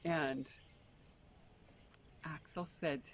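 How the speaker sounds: ordinary delivery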